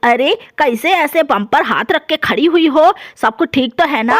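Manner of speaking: a cranky dadi just woken up, scolding; grumpy old grandmother. Acted. Grandmother voiced in story. (गुस्सैल बूढ़ी दादी की आवाज़)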